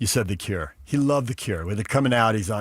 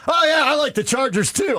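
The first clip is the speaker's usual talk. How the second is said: high, loud American bro voice; no accent, bro voice; 13 semitones higher, 3 dB louder than usual. Retold or imitated. Kayvon dropping his accent, high loud bro voice. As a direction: drop the accent, sound like a local jock. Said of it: "the accent is gone".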